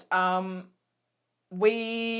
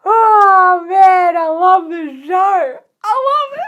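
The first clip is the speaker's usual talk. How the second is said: loud, high, drawn-out wail of love; overwhelmed fangirl; 8 semitones higher, 13 dB louder, slower than usual. Real, not acted. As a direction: wail it like you might cry with joy. Fangirl overwhelmed by favourite scene.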